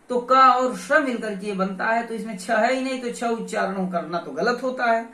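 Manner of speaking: ordinary talk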